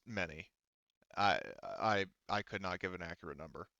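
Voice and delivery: low, quiet reluctant answer; reluctant officer. Acted. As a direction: keep it low and evasive, a little halting. GM voices the reluctant Brigadier answering.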